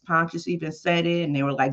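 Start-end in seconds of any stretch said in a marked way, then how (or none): none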